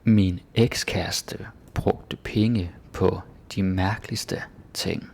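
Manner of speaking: slow, hushed Danish sentence; slow whispered read. Shown as a demonstration. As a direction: breathe it out slowly. Reads example slowly.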